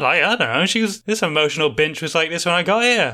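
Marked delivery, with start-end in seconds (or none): none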